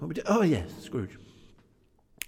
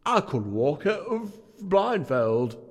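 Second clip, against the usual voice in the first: higher, louder and slow; feverish eagerness; 10 semitones higher, 6 dB louder, slower than usual. A narrator's reading. Scrooge answering the ghost with feverish eagerness. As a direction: insist eagerly, drawn out. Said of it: "cried Scrooge with a fever".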